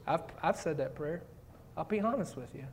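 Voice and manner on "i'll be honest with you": ordinary talk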